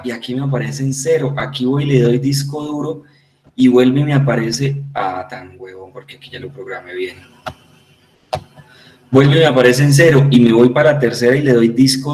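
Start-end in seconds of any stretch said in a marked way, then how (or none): 4.9-7.2 low, very soft grumble to oneself; self-reproach dropped to a quick, low mumble; muttered aside. Real, not acted. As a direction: mutter at your own slip under your breath. Muttered self-reproach over own slip.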